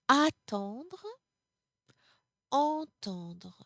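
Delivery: Attendre with open a, entendre with nasal ɑ̃ at the start; contrast a and en. Shown as a demonstration. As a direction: set Attendre against entendre by the first vowel. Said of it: "Notice the pronunciation here".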